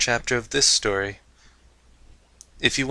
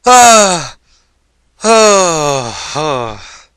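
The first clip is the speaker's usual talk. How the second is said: satisfied sighs; 4 semitones higher, 11 dB louder, much slower than usual. Acted. Sighing after the laugh.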